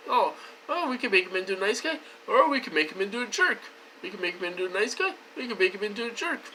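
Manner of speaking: high, quick mocking chatter; casual knob-twiddlers voiced fast and high; imitating the controllers. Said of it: "it's like the guys".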